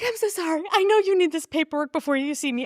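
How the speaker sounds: mock-distraught, high-pitched apology; high, pleading retelling of a sobbing client; retold tearful apology. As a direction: imitate a flustered, upset girl apologizing.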